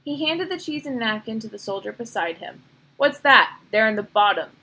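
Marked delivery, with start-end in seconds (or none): 3.0-4.5 louder, tense demand; sudden pointed questioning, louder; tenser voice. A narrator's reading. Soldier spotting something in the basket, tense and loud. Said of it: "he asked in a different, tenser voice".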